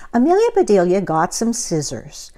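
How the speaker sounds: plain reading voice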